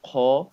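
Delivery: Kha said heavy and full-mouthed. Shown as a demonstration. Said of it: "to pronounce this sound".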